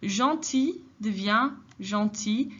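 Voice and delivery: slow, careful Gentil devient gentil; teacher modelling gentil slowly; slow model. Shown as a demonstration. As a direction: spell out each word slowly for students.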